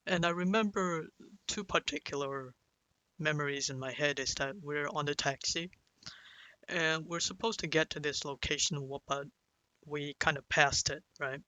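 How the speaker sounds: ordinary talk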